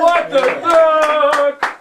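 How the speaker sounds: slow, stretched segment call; drawn-out announcer call. Acted. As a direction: stretch the name out like a radio jingle. Host announces segment name.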